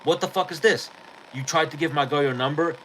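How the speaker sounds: mild mock yell of a furious man; retold angry confrontation. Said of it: "I'm like".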